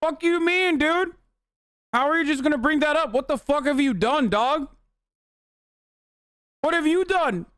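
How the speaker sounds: high, incredulous squeal of disbelief; mock outraged retort. Viewer mocking the cop's confession, high and outraged. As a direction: shriek it back at him like you can't believe your ears. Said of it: "It's like".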